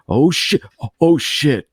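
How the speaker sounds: imitating a falling hero, breath knocked about; breath catching mid-fall. Player hears game hero falling from a ledge, breath caught. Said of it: "the breath is getting caught in his stomach".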